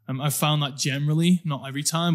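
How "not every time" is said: ordinary delivery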